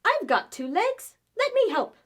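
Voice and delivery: high, loud eager chicken. Acted. Chicken offers Skelly help, high and loud. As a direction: squawk it out as a helpful hen. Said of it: "said a chicken".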